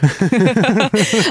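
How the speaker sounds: short nervous chuckle; nervous laugh. Real, not acted. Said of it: "Такой нервный смех".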